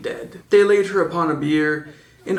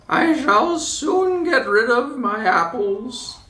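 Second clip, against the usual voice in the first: put-on old witch voice; 8 semitones higher than usual. A narrator's reading. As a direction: coax her like a sweet-talking hag. Disguised queen offers apple.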